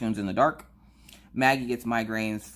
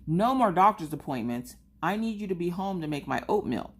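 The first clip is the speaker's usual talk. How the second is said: higher-pitched bossy kid imitation; Maggie; 8 semitones higher than usual. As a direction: lay down the law like an upset, pouty child. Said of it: "the way she was acting".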